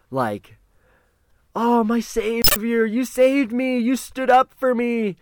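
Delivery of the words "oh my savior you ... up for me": high-pitched, gushing adoration; Camouflage. Retold or imitated. Kid greets the man who saved him, gushing high mock voice. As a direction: imitate an overjoyed fan, high and slow.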